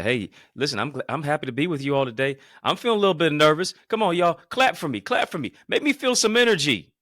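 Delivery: high, loud, fast pumped-up plea; rally the room to clap for you; hyped appeal to the crowd. Shown as a demonstration. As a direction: turn your nerves into crowd energy, loud and high. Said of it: "You see how you turn that on to the audience now".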